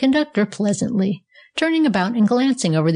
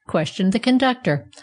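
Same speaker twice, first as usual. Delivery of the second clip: ordinary delivery, nothing marked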